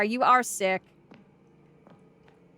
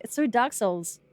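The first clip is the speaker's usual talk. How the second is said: ordinary talk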